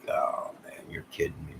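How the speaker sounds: soft whispered retelling of his own reaction; whispering in mock disbelief. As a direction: hush it like a shocked aside. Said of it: "I'm like".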